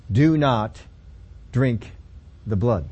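slow, with pauses after Do not and after drink; spaced out word by word, firm; very emphatic. Shown as a demonstration. Preacher stressing the Old Testament rule, emphatic. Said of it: "Very emphatically".

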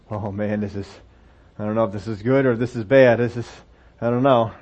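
uneasy, unsure of what's coming; nervous foreigner. Acted. Nervous foreigner before the ruler.

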